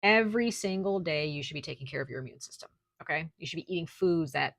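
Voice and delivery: ordinary talk, nothing marked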